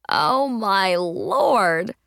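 very slow, swooping exclamation; drawn-out astonishment. A narrator's reading. Friend learning the nose ring is fake, drawn-out astonishment. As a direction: stretch each word in amazed disbelief.

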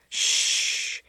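a soft, whispered shh; soothing shush. Acted. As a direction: hush her gently, just breath. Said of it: "he soothed".

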